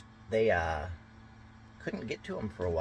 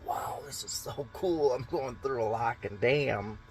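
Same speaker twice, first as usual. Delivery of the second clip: amazed tourist; 6 semitones higher than usual. Retold or imitated. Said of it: "you're just sitting there going".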